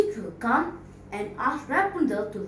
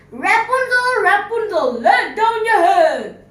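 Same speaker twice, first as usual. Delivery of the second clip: calling out; 8 semitones higher, 10 dB louder than usual. Acted. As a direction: call up to the window. Prince calls up the tower.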